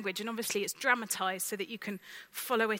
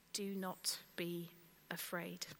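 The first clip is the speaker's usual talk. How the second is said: slow whispered angel's words; hushed heavenly comfort; 4 semitones lower, 10 dB quieter, slower than usual. Acted. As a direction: breathe it softly as the angel.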